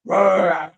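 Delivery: imitated angry roar, loud and high; mock roar. Husband roaring down the phone, imitated loud roar. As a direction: ham up a man roaring down the phone. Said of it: "roaring down the phone".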